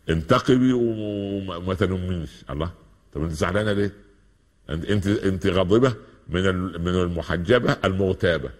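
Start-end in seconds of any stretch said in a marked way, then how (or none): none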